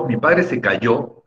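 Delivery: "cayó" stretched, weight on its last syllable "yó"; stress on final YO. Shown as a demonstration. Teacher giving example of father falling, "cayó" stressed on last syllable. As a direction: lean slowly into the end of "cayó": ca-YO. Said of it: "hay que pronunciar con énfasis la sílaba yo".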